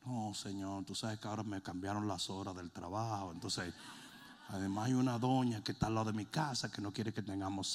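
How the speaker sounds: a low, sulky plea listing excuses; whiny excuse-maker. Retold or imitated. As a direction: mumble excuses to the Lord like a lazy church member. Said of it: "Y él hubiera dicho".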